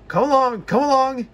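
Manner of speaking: high, loud urging chant; puffing call. A narrator's reading. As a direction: chug out come along in rhythm. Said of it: "Puff James".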